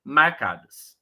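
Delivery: normal talking voice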